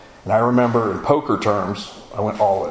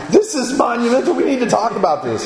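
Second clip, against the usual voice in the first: excited through laughter; 13 semitones higher than usual. Retold or imitated. Telling the pastor it's monumental, high and laughing. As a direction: bubble over with disbelief. Retelling his urgency, still laughing.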